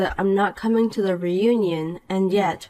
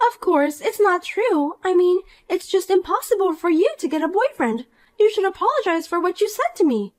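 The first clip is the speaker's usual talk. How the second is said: put-on bright, higher voice of a smug mean girl; snide rich girl; 10 semitones higher than usual. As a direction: talk down to her with sweet contempt. Poppy demands apology.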